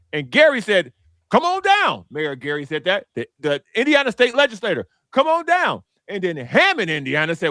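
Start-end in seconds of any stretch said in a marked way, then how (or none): 1.3-2.1 much higher, hammed-up game-show host call; lightly mock an eager host: come on down; city officials as a hammed-up game-show host; game-show invite. Mayor of Gary voiced inviting. 5.1-5.8 much higher, hammed-up game-show host call; lightly mock an eager host: come on down; city officials as a hammed-up game-show host; game-show invite. State legislators voiced inviting.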